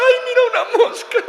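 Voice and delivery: high squeaky whimper, laughing; mock weepy woman. Preacher mocking women who cry over a fly, squeaky and laughing.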